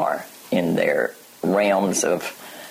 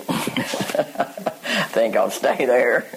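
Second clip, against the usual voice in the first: laughing through a drawn-out, higher line; slow, higher, with laughter breaking in; amused mock quote; 5 semitones higher, much slower than usual. Teacher jokingly voices someone content to stay unconscious, laughing.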